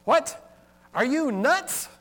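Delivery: high, loud incredulous "What? Are you nuts?"; exclamatory question. Shown as a demonstration. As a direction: throw it out in mock disbelief. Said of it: "So consider this one".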